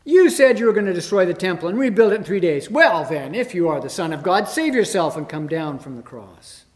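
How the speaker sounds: loud, fast jeering; fast scornful crowd heckling; mocking shout. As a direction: taunt him from the crowd. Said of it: "shouted abuse, shaking their heads in mockery".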